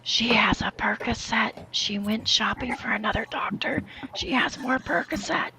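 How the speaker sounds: hushed ASMR whisper narration. Pretend. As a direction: whisper it like a nature documentary. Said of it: "A S M R Shanny reviews".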